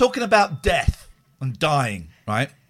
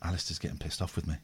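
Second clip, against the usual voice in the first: muttered aside; 12 dB quieter, much faster than usual. Acted.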